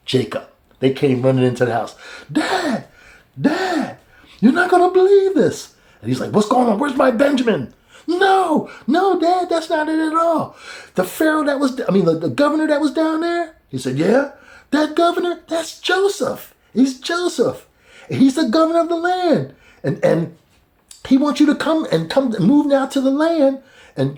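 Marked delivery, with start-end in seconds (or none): 2.3-5.7 very high, building breathless news; excited sons. Retold or imitated. Sons run in to Jacob. 6.3-7.7 raised, anxious mock voice; worried father. Jacob worried. 8.1-23.6 very high eager telling; excited sons. Retold or imitated. Sons tell Jacob news.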